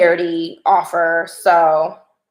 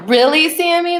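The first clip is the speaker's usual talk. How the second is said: excited; 8 semitones higher, 5 dB louder than usual. Real, not acted. Excited reaction to chat.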